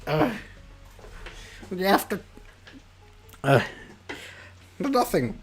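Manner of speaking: put-on a caught-out girl fumbling for excuses; flustered Cookie. Cookie, her surprise spoiled, complaining flustered to Sam.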